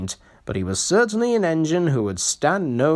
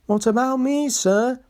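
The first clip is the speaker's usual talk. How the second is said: put-on old engine voice; 12 semitones higher than usual. A narrator's reading.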